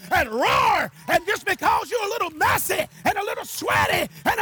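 high, loud, building exhortation; full-force preaching. Real, not acted. Preacher urges the weary to praise under pressure, full-force shouting. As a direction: shout it to the congregation, driving each phrase.

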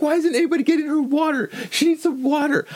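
very high, wailing hysterical impression; mock panic. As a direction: imitate a panicked brother in a squealing falsetto. Acts out brother panicking over grandma.

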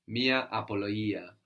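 "απολογία" with the γ softened to a y sound. Shown as a demonstration. Teacher correcting the student's hard g, γ said as y. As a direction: say "απολογία" as a-po-lo-YEE-a. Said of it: "Pronounced like a Y".

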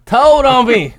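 high, loud aggrieved echo; indignant outburst. Real, not acted. Man recalling cousin tattling on him, indignant outburst. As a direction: hit it hard in comic outrage.

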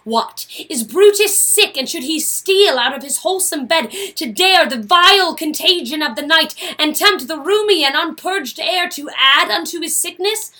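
scolding him with rising heat; angry Portia. Acted. Portia confronting Brutus about his sickness, angry. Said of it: "You were so mad".

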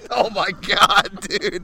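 slow startled exclamation; surprised shout. Real, not acted. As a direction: react to being soaked. Water spilled on him.

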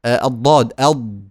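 أض with an emphatic dad; letter demo. Shown as a demonstration. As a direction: say أض, heavy and full. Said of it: "انطقوا وقولوا".

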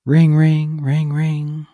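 sung ring ring imitation; phone ringing noise. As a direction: chirp out a fake phone ring.

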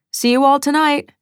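high-pitched call to the household; shouted farewell. Acted. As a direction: call out goodbye on the way out. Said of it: "and shouted".

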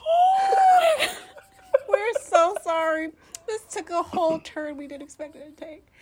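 high, loud, helpless laughing through the words; laughing so hard it turns to tears; crying with laughter. Real, not acted. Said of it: "I am crying".

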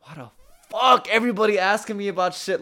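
loud exasperated yell, high; shouted quote. Retold or imitated. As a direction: yell it in disbelief. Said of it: "he comes to me like".

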